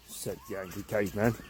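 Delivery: plain reading voice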